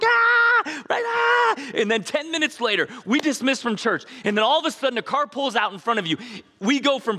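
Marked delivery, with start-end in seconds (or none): 0.0-1.7 loud, very high ecstatic cry; mock ecstatic worship shout. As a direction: shout it out like lost in praise. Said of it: "we're just like".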